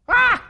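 sudden loud, high donkey cry; donkey bray. Retold or imitated. Donkey hit by the dirt, imitated as a loud high bray. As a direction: let out a shrill braying yelp like the buried donkey. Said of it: "and the donkey goes".

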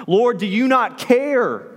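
slow, high-pitched mock shout of protest; indignant appeal, pitched high. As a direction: cry out in acted exasperation, drawing it out. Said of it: "and she shouts".

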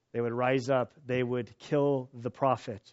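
ordinary talk

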